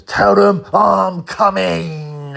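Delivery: very slow, high and loud gangster threat; menacing movie line. Retold or imitated. Terence Stamp's Limey quote, slow loud menace. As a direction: drag out the threat like a hard man. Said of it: "He goes".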